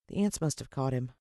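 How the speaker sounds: plain reading voice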